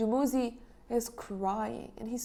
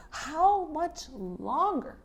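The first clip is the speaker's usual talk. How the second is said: slow, high angry demand; 7 semitones higher, much slower than usual. Retold or imitated. Reading Inanna's angry speech.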